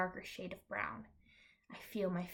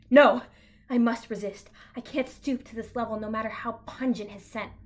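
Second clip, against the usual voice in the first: dramatic outburst; 3 semitones higher, 12 dB louder than usual. Acted. Inner outburst, dramatic resistance.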